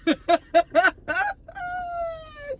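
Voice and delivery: sudden high-pitched laugh; real high laugh. Real, not acted. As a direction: let out a high cackle. Laughing after outburst.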